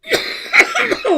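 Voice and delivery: loud hacking cough; coughing fit. Real, not acted. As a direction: cough hard and high. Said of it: "Excuse me, my cough".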